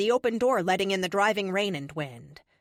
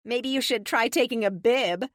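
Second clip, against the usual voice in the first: raised, louder teasing voice; joking; 4 semitones higher, 5 dB louder than usual. A narrator's reading. Tessa ribbing the messy eater, jokingly. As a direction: tease him lightly, pitched up. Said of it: "Tessa says jokingly".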